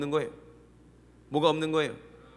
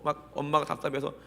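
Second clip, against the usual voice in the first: usual speaking voice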